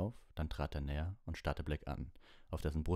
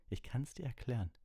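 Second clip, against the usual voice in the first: higher-pitched, pleading; urgent call; 8 semitones higher than usual. A narrator's reading. Lupin offering Hermine an explanation, urgent plea. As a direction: plead to be heard. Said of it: "rief Lupin".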